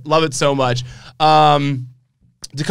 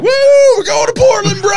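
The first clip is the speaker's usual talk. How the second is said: much higher, louder whoop of joy; excited shout; 7 dB louder than usual. Retold or imitated. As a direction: yell it like you're taking off on a party plane. Retells a wrestler cheering on the plane.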